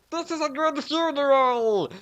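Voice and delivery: put-on high squeaky kid voice; screeching. A narrator's reading. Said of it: "screeched Nair".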